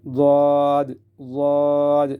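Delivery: long, heavy Daad; tongue edge along upper molars for Daad; molar-side Daad. Shown as a demonstration. Teacher shows tongue on molars, stretching heavy Daad. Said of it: "داڑھوں سے لگا رہے تھے".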